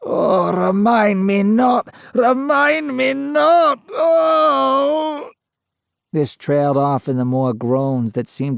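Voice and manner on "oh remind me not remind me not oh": slow, rising to a high crying shout; growing louder. A narrator's reading. Ghost wailing in anguish, louder and louder. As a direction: build to an anguished wail. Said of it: "Growing much louder".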